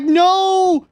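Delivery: shrieking no over and over, very high and loud; real terror as it closes in; panicked screaming. Real, not acted. Chased by monster, panicking.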